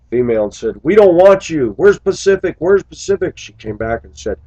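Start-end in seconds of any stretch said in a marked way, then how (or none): 0.8-3.4 put-on high loud childish shouting; kids yelling. Upset kids yelling at the substitute driver, loud high shouts.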